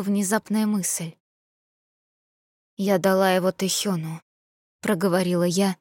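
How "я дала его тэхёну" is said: usual speaking voice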